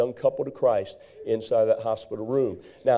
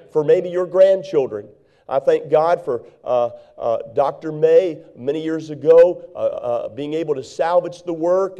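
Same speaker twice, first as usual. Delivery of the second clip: higher and louder, urgent sermon voice; fiery preaching; 3 semitones higher, 4 dB louder than usual. Acted. Preacher exhorting the church to strengthen what remains, loud and fervent. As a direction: drive it home to the congregation.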